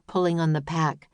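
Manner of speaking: ordinary delivery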